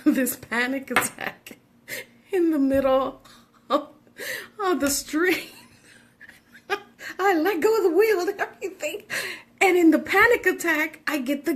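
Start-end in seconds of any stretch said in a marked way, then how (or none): 0.0-9.0 high giggling words; laughing while telling. Real, not acted. Mom giggles retelling her panic in the car. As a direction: talk through the giggles.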